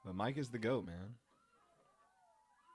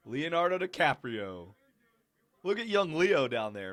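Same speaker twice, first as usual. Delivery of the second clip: louder, higher, slow delighted call-out; excited recognition; 6 semitones higher, 9 dB louder than usual. Real, not acted. Spotting young Leo in the crowd, delighted and loud. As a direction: point him out with glee.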